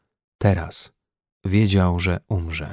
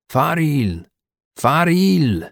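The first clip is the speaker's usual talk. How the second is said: higher-pitched drawn-out call, falling at the end; calling out a name; 6 semitones higher, much slower than usual. A narrator's reading. Rescuers shout to the man behind an insulated door, calling out. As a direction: shout the surname as if through a door. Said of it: "wykrzykiwane własne nazwisko".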